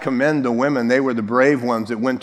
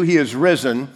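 ordinary talk, nothing marked

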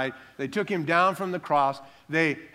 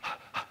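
mock exertion puffing; 12 dB quieter than usual. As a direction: pretend heavy-lifting puffs. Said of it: "He probably went".

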